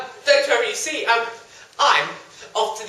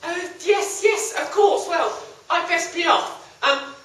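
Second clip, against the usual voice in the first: high nervous voice; 8 semitones higher, 4 dB louder than usual. Acted. Young man hurries away.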